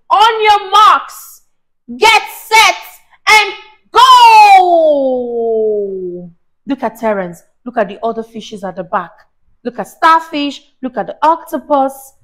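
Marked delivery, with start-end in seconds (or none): 0.1-6.3 high, loud, slow starter's call; race starter call. Acted. Mother voicing the swordfish starting the race, loud call. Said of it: "the one that would say".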